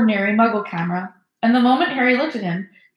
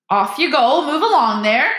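high, loud breezy call; calling to crowd; 5 semitones higher, 6 dB louder than usual. A narrator's reading. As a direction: shoo the crowd along grandly. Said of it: "Lockhart called to the crowd".

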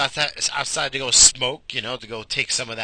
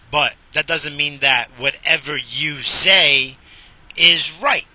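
slow, loud build to right; slow, louder, punching right; emphatic point; 5 dB louder, slower than usual. Real, not acted. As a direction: insist hard on right. Arguing his point emphatically.

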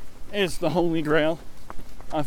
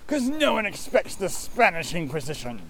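hammed-up cardinal's proclamation; Monty Python; 2 semitones higher than usual. Paying off co-runner's setup, Monty Python proclamation. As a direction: burst in with mock menace. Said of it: "I'm trying to do Monty Python".

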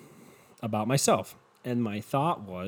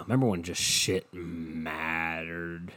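slow and lower, last word stretched; 5 semitones lower, slower than usual. Real, not acted. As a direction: draw "mattered" right out. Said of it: "It sounds so slow".

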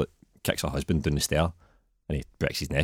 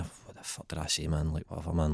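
quiet regret; 10 dB quieter than usual. Retold or imitated. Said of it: "phone calls you'd made".

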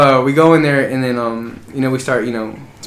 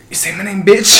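mock tough comeback; 5 semitones higher than usual. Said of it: "my reply should have been".

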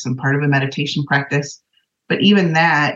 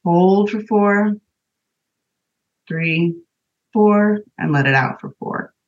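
slow breath counting; 4 semitones higher, much slower than usual. Shown as a demonstration. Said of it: "okay, ready?".

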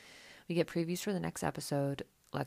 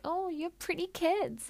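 very high, slow, sugary mock compliment; mock fake sweetness; 4 dB louder, slower than usual. Mocking Danielle's fake kindness to friends, high sugary voice. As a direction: parody a fake-nice tone. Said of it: "It's all an act".

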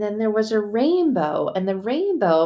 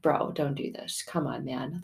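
soothing plea; 4 semitones lower, 9 dB quieter than usual. Retold or imitated. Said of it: "talking God off the ledge".

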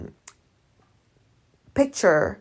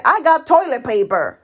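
blunt announcement; 5 semitones higher, 5 dB louder than usual. Shown as a demonstration. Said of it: "you go and you said".

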